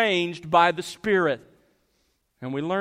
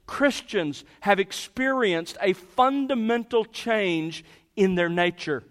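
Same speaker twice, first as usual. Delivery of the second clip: raised, louder preaching that builds its point; impassioned preaching; 4 semitones higher, 3 dB louder than usual. Acted. Preacher urging the congregation about their change, fervent. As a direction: drive it home from the pulpit.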